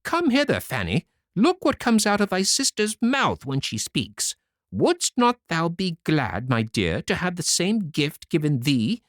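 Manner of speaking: high, sugary persuading voice; mother urging Fanny to the fountain; coaxing mother. Acted. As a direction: sweet-talk the spoiled daughter. Mother coaxes her favourite daughter.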